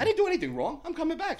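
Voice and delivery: squeaky high falsetto; mock innocent. Answering the phone call about the cops, mock innocent falsetto. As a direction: play it sweet and innocent, way up high. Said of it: "I'm like saying".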